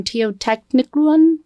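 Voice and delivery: "Teotihuacan" mangled, slow and questioning; botched pronunciation. Real, not acted. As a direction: stumble slowly through "Teotihuacan", unsure of it. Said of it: "I'm gonna destroy the pronunciation".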